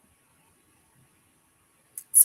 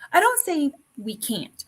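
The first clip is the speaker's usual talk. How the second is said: ordinary delivery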